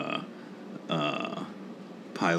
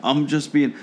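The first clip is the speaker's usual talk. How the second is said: high, loud, scrambling backpedal, retold; flustered apology; 11 semitones higher, 5 dB louder than usual. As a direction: act out frantically taking it back. Retold panicked apology to offended coworker.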